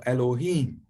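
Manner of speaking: "Elohim" with emphasis on final him; stressed last syllable. Shown as a demonstration. Teacher modelling a word for God, "Elohim" stressed on the last syllable. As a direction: say "Elohim" el-o-HEEM. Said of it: "that's the stressed vowel".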